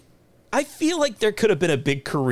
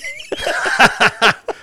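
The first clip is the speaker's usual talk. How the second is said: hearty laugh; 8 semitones higher, 6 dB louder than usual. Real, not acted. Reacting to the accent challenge.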